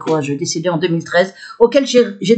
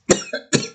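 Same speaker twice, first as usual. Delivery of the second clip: inflamed-throat cough; brief real coughing fit; 6 dB quieter than usual. Real, not acted. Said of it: "Je tousse parce que très enflammée".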